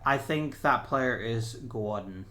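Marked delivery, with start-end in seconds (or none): 1.5-2.3 Boston-style Gordon with no r; Boston accent. Real, not acted. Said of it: "saying Gordon with a Boston accent".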